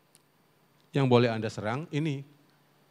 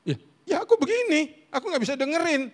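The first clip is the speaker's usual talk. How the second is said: high, louder self-mocking protest; hammed-up defensive whine, pitched way up; defensive husband; 4 dB louder than usual. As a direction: blurt it out as a cornered husband. Acts his defensive reply to wife.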